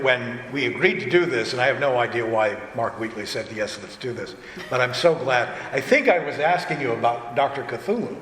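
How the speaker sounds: usual speaking voice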